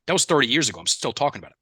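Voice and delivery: ordinary talk, nothing marked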